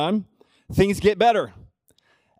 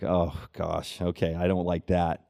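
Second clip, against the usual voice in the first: much softer and lower, deflated; dejected mumble; 13 semitones lower, 8 dB quieter than usual. Retold or imitated. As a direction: let it sink in, crestfallen and quiet. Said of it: "I was like".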